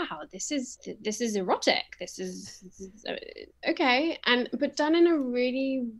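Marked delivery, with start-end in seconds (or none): 0.1-4.2 stunned reaction. Retold or imitated. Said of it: "I was like".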